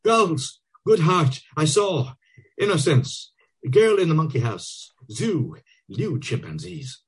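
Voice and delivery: high, desperate pleading; begging in panic, voice pitched high. A narrator's reading. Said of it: "Bloom, desperately".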